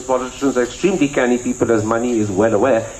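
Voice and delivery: ordinary delivery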